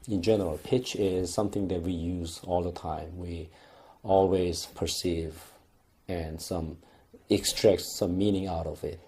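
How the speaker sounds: normal talking voice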